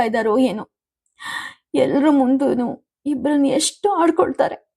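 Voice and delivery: high, hurt, weepy complaint; tearful. A narrator's reading. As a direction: voice a wife on the edge of sobbing. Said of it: "Musi Musi ಅತ್ತರು".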